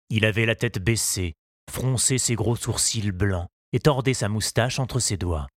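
plain reading voice

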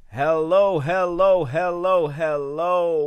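booming hellos to open the show; loud greeting. Real, not acted. Host greets listeners with a loud opening. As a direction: call out the hellos like a hype host.

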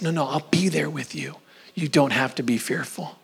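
calm someone who is scared, in a whisper; gentle whisper. Acted. Voicing God's reassurance.

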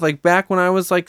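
ordinary talk

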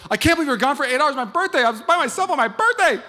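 high, loud birthday whine; husband complaining he was left alone; whiny complaint. Retold or imitated. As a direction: whine like a wounded birthday boy. His whining complaint.

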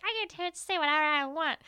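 put-on sassy girlfriend comeback; mock bratty retort. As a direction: play the girlfriend snapping back. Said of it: "And she's like".

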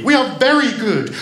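put-on high, loud and keen child voice; eager. A narrator's reading. Children assuring the girl they are good, eager. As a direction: insist eagerly, pitched right up. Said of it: "they answered eagerly".

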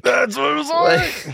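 slow, squeaky mock narrator; high put-on voice. Echoing the Carlin impression.

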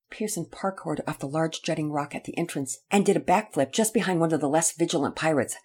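ordinary delivery

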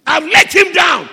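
high, loud shouted admission; impassioned preacher's shout; Peter's anguished confession. Acted. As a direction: cry out the failure.

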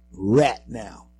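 Louisiana "rat now" with a flat short a; Louisiana drawl. Shown as a demonstration. Host imitates Louisiana speech in a Louisiana accent. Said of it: "as they say in some parts of Louisiana".

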